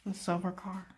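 usual speaking voice

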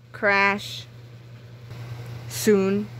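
usual speaking voice

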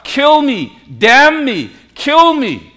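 high, loud self-cursing cries; acted curse, each phrase thrown out high and loud; pronouncing a curse. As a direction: call down death on yourself, as Peter. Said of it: "he was pronouncing a curse".

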